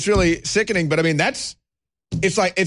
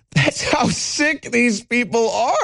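normal talking voice

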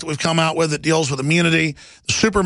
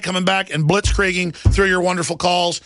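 ordinary delivery

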